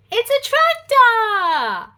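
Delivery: high, slow delight; excited reveal. Acted. Reading to a toddler, a thrilled tractor reveal. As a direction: gasp out the tractor with wonder.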